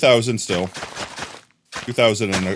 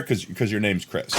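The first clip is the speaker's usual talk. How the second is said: usual speaking voice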